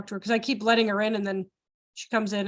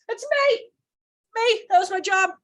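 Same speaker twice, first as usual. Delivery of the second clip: high, loud mock voice; imagined voice; 10 semitones higher, 5 dB louder than usual. Joking to Donna about hearing voices, high mock voice. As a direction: pipe up high and loud in jest. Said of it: "Donna heard voices. It was like".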